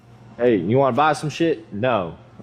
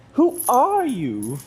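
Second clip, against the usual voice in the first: drawn out slow and much higher, mock startled; startled who; 13 semitones higher, much slower than usual. Real, not acted. Vlogger startled by someone appearing, slow high mock surprise. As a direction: stretch it high and slow like you're spooked.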